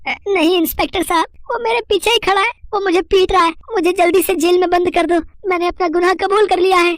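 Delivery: very high, terrified insistence; frantic pleading. Acted. Thief begging the inspector for jail, high-pitched terror. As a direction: beg in a high, panicked squeal.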